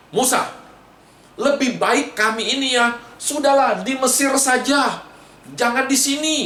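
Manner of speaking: high-pitched complaint to Moses; acted indignant protest; grumbling. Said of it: "Dia bersungut-sungut kepada Musa".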